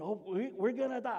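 high, loud mock panic; freaking out. Disciples sure the boat will sink, mock panic. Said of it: "Freaking out and going".

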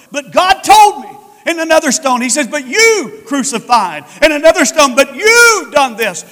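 loud, rising preacher's cry; fiery preaching. Acted. Preacher shouting Stephen's defiance to his stoners, loud and forceful.

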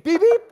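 high, loud horn beeps; car horn imitation. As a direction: honk sharply like a little car horn. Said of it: "It's like a car horn".

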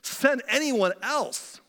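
understated begging; pleading. A narrator's reading. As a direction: plead slowly, drawing out each word. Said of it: "Moses again pleaded".

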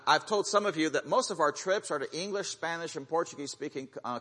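usual speaking voice

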